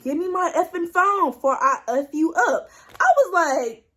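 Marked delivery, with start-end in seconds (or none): 0.0-2.7 retold angry demand. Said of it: "he starts getting louder and louder".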